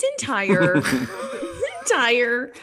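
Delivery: laughing through the words. Real, not acted. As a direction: crack up mid word. Laughing mid sentence.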